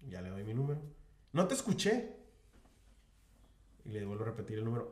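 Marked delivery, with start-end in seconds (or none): 1.3-2.2 high, dismissive complaint; mock clerk who claims not to hear; rude clerk. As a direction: brush him off from behind the counter. Rude clerk voiced in story.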